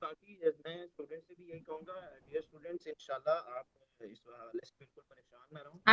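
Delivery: very faint call audio; faint connection. Real, not acted. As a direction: voice fading out on the line. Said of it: "आपकी आवाज भी बहुत जोर से बोलें".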